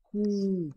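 coup said short and sharp, like an exclamation; punched coup. Shown as a demonstration. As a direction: bark out coup as if it had an exclamation mark. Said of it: "pour bien marquer coup".

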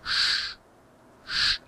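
"Sch-" as a bare voiceless hush; isolated sch sound. Shown as a demonstration. Teacher corrects the student's opening sound, hushing "Sch-". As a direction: give just the "sch" with no vowel. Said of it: "ja, und die Betonung".

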